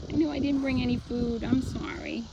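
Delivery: high, soft apologetic coo. Real, not acted. Apologizing to horse, no food.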